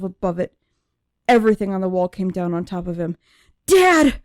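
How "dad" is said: high, loud scream of Dad!; desperate full-voiced scream. A narrator's reading. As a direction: shriek for her father as he falls. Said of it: "I screamed".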